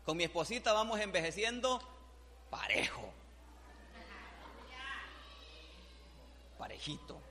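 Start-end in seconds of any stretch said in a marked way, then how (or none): 2.5-7.2 breathy whisper, almost no voice; whispered aside. Real, not acted. Pastor whispers fondly about growing old with his wife.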